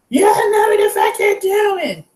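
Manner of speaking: high mock scream of an angry old man; imitated yell. Mimicking the man yelling from his truck, high mock scream. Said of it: "yelling and screaming, like, at the top of their lungs".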